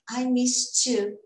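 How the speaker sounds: I missed you with missed ending on a T; slow model, missed with final T. Shown as a demonstration. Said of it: "É só um miss no passado".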